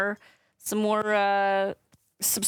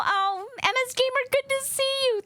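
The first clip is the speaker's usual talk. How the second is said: excited greeting; faster than usual. Real, not acted. Greeting viewer in chat.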